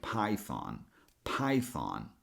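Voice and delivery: PYTHON as PIE then THON with an open AH; pie plus thon. Shown as a demonstration. Teacher joining both syllables, "python" ending in an open "thon". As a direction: say "python" with unvoiced TH and a full AH. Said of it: "both syllables together sound like".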